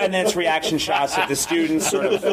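ordinary talk, nothing marked

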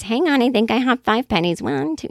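put-on little old lady. Old lady holding up the grocery line, lightly imitated. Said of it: "those jokes at the grocery store, the little old ladies".

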